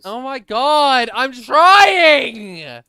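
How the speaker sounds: slow, loud, high wailing protest; exasperated yell. Real, not acted. Streamer protesting to nagging friend that he's trying, exasperated yell. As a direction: wail it loudly, stretching the last word.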